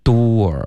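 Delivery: DOOR drawn out as "doo-wor"; rounded lip glide. Shown as a demonstration. Teacher contrasting the two words, slow lip glide. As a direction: say door slowly with rounded lips. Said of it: "所以第一".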